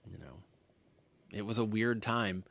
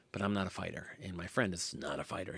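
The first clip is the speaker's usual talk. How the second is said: ordinary talk, nothing marked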